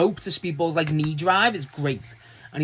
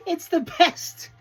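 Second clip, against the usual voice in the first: delighted gush; 13 semitones higher, much slower than usual. Real, not acted. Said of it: "gushing over the name".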